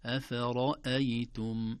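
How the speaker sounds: "أفرأيتم" slow, every letter thin except a full ر; light letters, heavy ra. Shown as a demonstration. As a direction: keep the vowels of "أفرأيتم" light, only ر heavy. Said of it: "هكذا". («أفرأيتم» بترقيق الحروف وتفخيم الراء وحدها)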